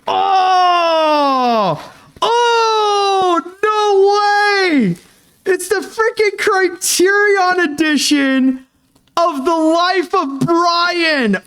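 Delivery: high, cracking cries of delight; excited squeal. Real, not acted. Fan opening a gift Blu-ray, shrieking with delight. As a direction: squeal with real joy at the gift.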